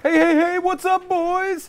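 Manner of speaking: high, loud party-bro hello; loud goofy American bro; brash loud greeting. Acted. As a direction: barge in loudly. Brash American sketch entrance.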